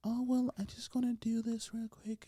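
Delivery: Megan Fox impression. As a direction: mimic her breathy actress switch. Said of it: "start talking like that".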